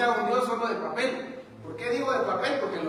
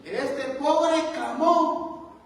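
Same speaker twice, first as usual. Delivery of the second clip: "Este pobre clamó" higher, loud and very slow; emphatic preaching; 6 semitones higher, 5 dB louder, much slower than usual. Acted. Preacher repeating David's verse to the church, slow and loud. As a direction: stretch "pobre" and proclaim.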